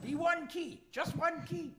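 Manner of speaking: high-pitched, insistent creature voice; pleading troll. Acted. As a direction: plead like an anxious little troll. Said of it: "he rushes over and grabs your hand and says".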